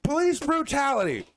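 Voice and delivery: high, mock outraged cry of police brutality. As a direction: squeal it like a hammed-up victim. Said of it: "I'm going".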